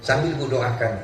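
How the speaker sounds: ordinary delivery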